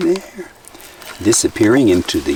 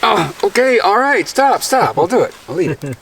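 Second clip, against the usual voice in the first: flustered protest; 10 semitones higher than usual. Real, not acted. Pressed to eat the big one.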